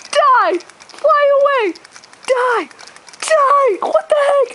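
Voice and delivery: high, loud repeated yells; shouting. Real, not acted. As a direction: yell it at the bird. Spraying the bird, shouting.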